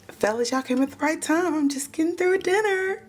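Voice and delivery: high, sweet fake-innocent greeting; sugary hostess voice. Rock welcoming cops at the door, high sugary voice. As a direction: greet them like a syrupy hostess.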